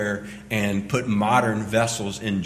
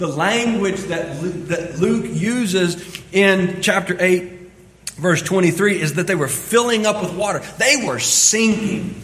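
loud, fast-building sermon delivery; preaching at full force; 9 semitones higher, 8 dB louder than usual. Acted. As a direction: drive the point home to the congregation. Preaching with rising intensity.

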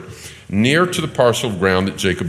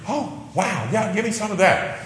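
high mock-gushing sarcasm; a high, sarcastic put-on voice, pretending to be thrilled; 12 semitones higher than usual. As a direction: fake wide-eyed enthusiasm, dripping sarcasm. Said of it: "I would suspect this is sarcasm".

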